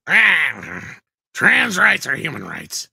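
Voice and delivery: slow squawky penguin voice; squawky bouncy penguin. Pretend. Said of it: "Tom, read it like the penguin".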